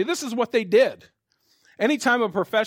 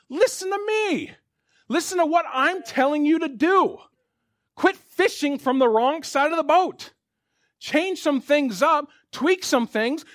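fervent preaching; 6 semitones higher than usual. Acted. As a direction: drive the point home to the congregation. Preaching builds to full force.

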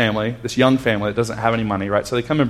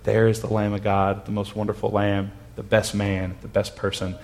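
softer, lower, almost whispered awe; 3 semitones lower, 8 dB quieter than usual. Retold or imitated. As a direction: hush it like you can barely speak. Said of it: "he can only whisper".

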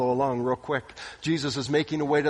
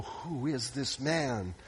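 awed hush; 3 semitones lower, 9 dB quieter, much slower than usual. Acted. Disciples awed after storm calms.

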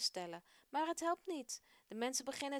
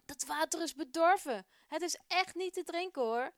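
loud, high complaint about the spoiled water; angry voices; 7 semitones higher, 8 dB louder than usual. Acted. An angry man at the well complains, loud and cross. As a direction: protest loudly and crossly. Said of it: "horen ze boze stemmen".

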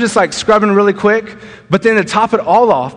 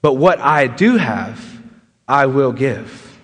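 compassionate Peter; 5 semitones lower, slower than usual. Retold or imitated. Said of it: "what Peter says afterwards".

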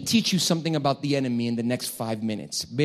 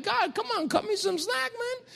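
mock whining to God; 5 dB quieter than usual. Pastor to God, high whiny mock complaint. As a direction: plead comically for a break.

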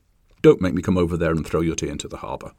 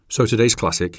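grudging mock-grumble, a touch louder; through gritted teeth; 4 semitones higher, 4 dB louder than usual. Host mock-grudgingly introducing today's classic, through gritted teeth. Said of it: "he said through gritted English teeth".

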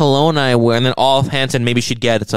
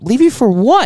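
high, louder incredulous comeback; retold incredulity; 9 semitones higher, 5 dB louder than usual. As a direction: throw the question back in disbelief. Retold argument with a date.

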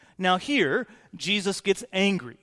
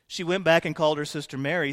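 ordinary talk, nothing marked

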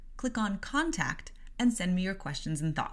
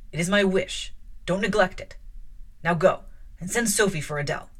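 measured, louder reading voice with lightly voiced characters; story narration; 5 dB louder than usual. A narrator's reading. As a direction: read the novel aloud to listeners. Reads novel aloud with voices.

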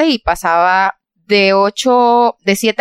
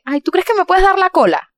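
higher, sing-song manager voice; imitating the manager; 10 semitones higher than usual. Manager asking Giselita for a car, higher sweet voice. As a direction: ask it sweetly in her voice. Voicing manager begging ride.

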